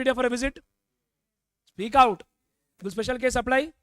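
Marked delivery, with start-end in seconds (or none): 1.7-2.2 sharp call to speak up; loud command. Real, not acted. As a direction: bark it out to the class. Teacher calling for an answer.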